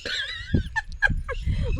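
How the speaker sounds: high burst of laughter. Real, not acted. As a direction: break into a giggle. Laughing with mother-in-law. (சிரிப்பு)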